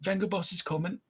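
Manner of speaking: put-on lightly done northern English accent, soft; a hint of northern bus driver. Bus driver calling out in a light northern accent. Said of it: "just do it in a northern accent".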